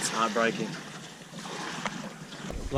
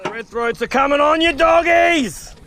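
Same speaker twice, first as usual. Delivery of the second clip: much higher, ringing out to the fish; excited call; 12 semitones higher than usual. Real, not acted. Fisherman hollers at the biting fish, high excited call. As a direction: sing it out like a fired-up callout.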